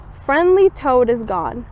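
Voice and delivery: ordinary delivery, nothing marked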